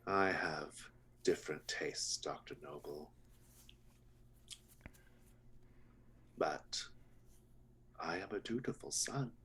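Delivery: a dutiful son making a polite threat; sinister butcher. Acted.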